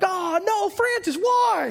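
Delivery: high, loud mock wail of dismay; dismayed crowd outcry. Retelling the teens' groan at Francis, high mock wail. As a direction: cry out like a shocked crowd of teens. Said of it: "we're like".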